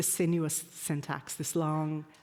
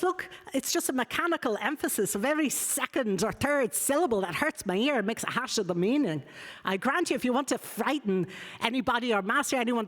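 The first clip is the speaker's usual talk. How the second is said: put-on higher-pitched, amateur Scots accent; attempted Scots accent; 7 semitones higher than usual. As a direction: read it in an imitated Scots lilt. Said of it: "I can't do a Scots accent".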